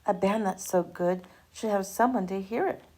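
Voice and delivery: ordinary delivery, nothing marked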